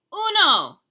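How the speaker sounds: very loud high shout. A narrator's reading. Dog shouting the count, very loud. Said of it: "as the doggy shouted".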